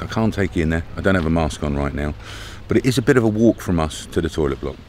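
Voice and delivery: ordinary talk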